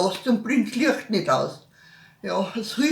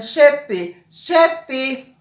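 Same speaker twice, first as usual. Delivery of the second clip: loud call across the house; 4 semitones higher, 5 dB louder, slower than usual. Retold or imitated. Child yelling for big brother. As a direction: shout the brother's name from afar. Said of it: "wenn mein Bruder schreit".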